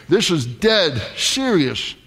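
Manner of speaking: "dead" leaned on, whole line slowed; slow down and hit "dead" hard; "This is dead serious" with weight on "dead"; stress on dead. Shown as a demonstration. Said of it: "Emphasis on the word dead".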